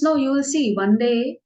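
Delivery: confident, rising assurance; insistent. Acted. Krishna insists to Radharani.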